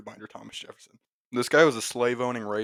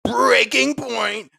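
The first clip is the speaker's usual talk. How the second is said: high, drawn-out anguished shout; loud cry at the breaking point; yelling; 13 semitones higher, 9 dB louder, much slower than usual. Acted. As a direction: stretch it out slowly at a high yell. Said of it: "I'm yelling so loud now".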